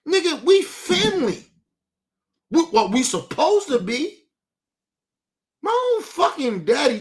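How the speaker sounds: hurt, angry outburst. Real, not acted. About his own father, hurt and angry outburst. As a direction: it breaks out of him.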